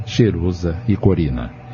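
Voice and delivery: usual speaking voice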